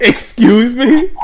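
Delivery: high, drawn-out mock indignation; meme voice quote. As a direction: stretch it out like the meme. Quoting the famous meme line.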